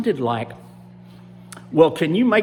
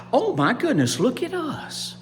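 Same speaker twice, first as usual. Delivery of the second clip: high, wondering exclamation; amazed gators; 9 semitones higher than usual. Acted. Gators seeing their changed skin, high amazement. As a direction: let the surprise lift the pitch. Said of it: "they stood up and they said".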